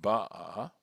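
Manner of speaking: "ba'a" with the first syllable stressed. Shown as a demonstration. Teacher showing the shifted accent, "ba'a" stressed on the first syllable. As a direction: punch the "ba" of "ba'a". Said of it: "So now the accents on".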